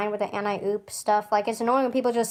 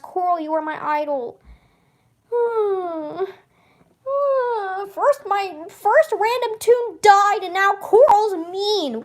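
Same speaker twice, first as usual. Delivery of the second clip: upset lament; 10 semitones higher, 4 dB louder than usual. Real, not acted. A fan lamenting her favorite YouTuber, high near-crying voice. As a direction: grieve over a fallen idol. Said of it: "I'm so sad and torn up about Coral".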